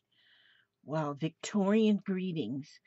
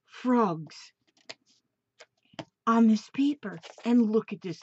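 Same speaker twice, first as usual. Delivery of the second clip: higher and louder, bubbling over; excited; 5 semitones higher, 6 dB louder than usual. Real, not acted. Showing viewers the frog pages, excited and gushing. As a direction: gush over every page.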